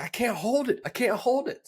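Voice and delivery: high, urgent put-on plea; mock desperation. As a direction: imitate someone desperate for the bathroom. Acted urgent bathroom plea.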